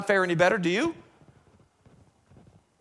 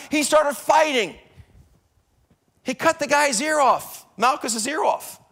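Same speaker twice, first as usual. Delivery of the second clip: higher, louder preacher's build to a shout; full-force preaching; 7 semitones higher, 5 dB louder than usual. Acted. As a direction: preach it hard, climbing toward a shout.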